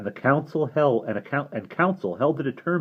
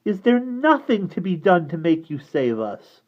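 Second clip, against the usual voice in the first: put-on pleading girl; 7 semitones higher, 3 dB louder than usual. Derna begs.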